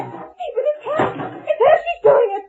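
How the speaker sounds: high, breathless terror; gasping out that the ghost is here; terrified realisation. Acted. Wife realises ghost is attacking.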